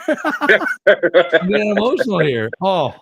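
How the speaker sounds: laughing through welling emotion; getting emotional. Real, not acted. To the couples he admires, emotional laughing voice. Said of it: "Oh, I'm getting emotional".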